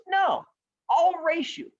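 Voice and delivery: high-pitched turtle voice; turtle character. Acted. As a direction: pipe up high as the plucky turtle. Turtle answers in story.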